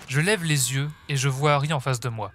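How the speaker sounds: ordinary delivery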